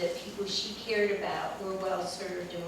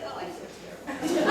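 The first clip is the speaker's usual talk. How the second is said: higher, breaking into a laugh; laughing reversal; 9 semitones higher, much faster than usual. Retold or imitated. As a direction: confess it and crack up.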